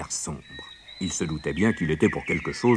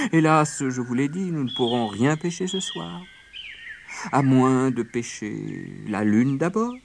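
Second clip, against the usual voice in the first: falsely sorry rabbit; 6 semitones higher than usual. A narrator's reading. Rabbit planting an idea in friends, mock regretful.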